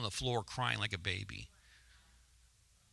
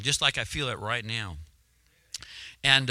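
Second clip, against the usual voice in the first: ordinary talk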